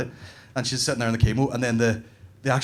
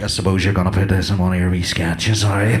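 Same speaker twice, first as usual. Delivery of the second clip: optician up close; 5 semitones lower, 6 dB louder than usual. Retold or imitated. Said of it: "talking into my mouth".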